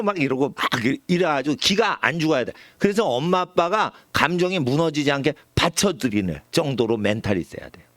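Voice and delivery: usual speaking voice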